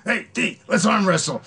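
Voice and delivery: high, loud put-on voice; imitated eager challenge. Jeffrey challenging D at four in the morning, high mock eagerness.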